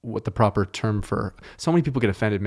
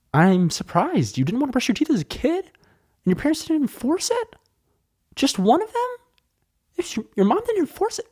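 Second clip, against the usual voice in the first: mock surprise; 13 semitones higher than usual. Sarcastic mock disbelief at himself.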